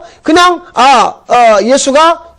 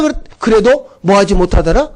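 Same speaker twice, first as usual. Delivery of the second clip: ordinary talk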